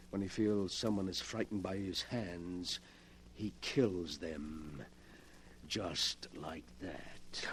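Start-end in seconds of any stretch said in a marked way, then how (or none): 5.7-7.5 whispered, slow and chilling; a cold whispered aside; menacing whisper. Acted. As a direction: breathe it out like a threat. Villain explains killings menacingly.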